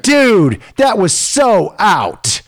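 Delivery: high, loud mock outburst of the mother; mocking the angry mom. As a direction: squeal it like an outraged hockey mom. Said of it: "she says".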